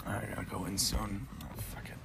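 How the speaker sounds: a soft whisper, away from the mic; quick whispered aside, then give up. Real, not acted. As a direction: whisper it to yourself.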